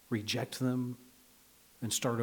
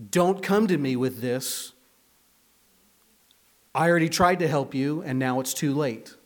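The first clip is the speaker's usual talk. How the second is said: stern rejection; 2 semitones higher, 7 dB louder than usual. Retold or imitated. God's rejection, imagined.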